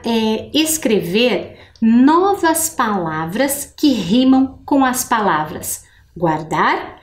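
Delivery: normal talking voice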